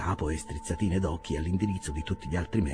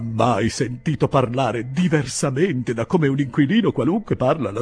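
high, loud, insistent protest; great vehemence; 8 semitones higher, 9 dB louder than usual. A narrator's reading. Pickwick defends his conduct with his landlady, vehemently. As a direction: challenge them all vehemently. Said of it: "con grande veemenza".

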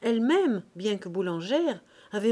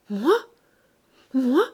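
loud, high, outraged questions; stunned, rising protest; choking with indignation; 5 semitones higher, 9 dB louder than usual. Acted. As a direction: gasp it out in disbelief. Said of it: "Elle suffoquait".

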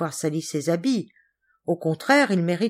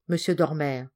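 ordinary delivery, nothing marked

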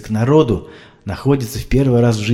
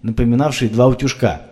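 plain reading voice

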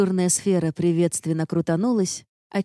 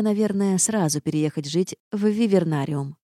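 ordinary delivery, nothing marked

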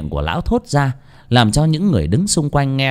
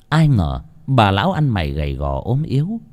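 ordinary delivery

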